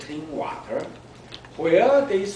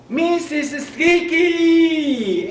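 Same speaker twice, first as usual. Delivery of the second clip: delighted gasp; 12 semitones higher, 8 dB louder, much slower than usual. A narrator's reading. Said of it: "Abby breathed".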